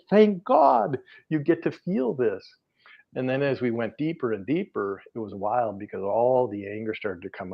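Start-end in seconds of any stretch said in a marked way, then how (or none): 0.1-2.4 higher, slow and glowing; joyful relief. Retold or imitated. Healer rejoicing with a client, slow and high. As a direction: savour each word with delight.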